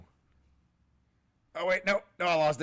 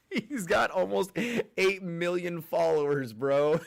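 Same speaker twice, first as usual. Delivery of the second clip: laughing; 6 semitones higher, 4 dB louder than usual. Real, not acted. Laughing while telling the follower count.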